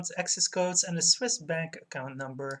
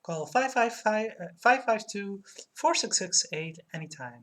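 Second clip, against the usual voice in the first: ad phone number; 4 semitones higher, 3 dB louder than usual. Acted. Streamer reading the limo ad's number, commercial-style read. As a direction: spell out the number for the listener, ad style.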